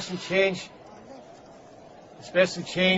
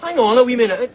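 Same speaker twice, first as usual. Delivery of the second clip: calling back; 6 semitones higher, 4 dB louder than usual. Acted.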